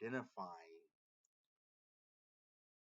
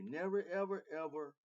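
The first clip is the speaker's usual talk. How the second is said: loud rising insistence; building emphatic outburst; emphatic never ever; 8 semitones higher, 9 dB louder than usual. Real, not acted. Emphatic rant to viewers.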